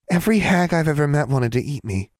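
lightly mimicked girl's protest, high-pitched; mimicking Alexandra. A narrator's reading. Hag repeats the girl's words to her, mimicking. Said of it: "mimicking Alexandra's voice with startling accuracy".

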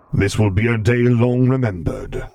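a touch of the dark lord's gravity; Vader's voice. A narrator's reading. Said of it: "said Vader".

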